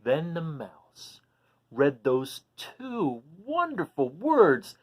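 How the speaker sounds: slow, savoring storybook build-up; slow dramatic read-aloud; slow suspense. A narrator's reading. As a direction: draw out each word before the big moment.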